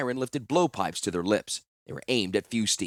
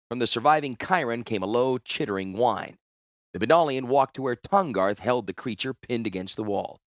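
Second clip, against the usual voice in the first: usual speaking voice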